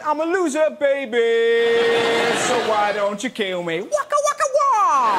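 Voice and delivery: lightly voiced [name], rising to a yelp; hammed-up [name] croon; [name] impression. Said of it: "I bet later on [name] was going".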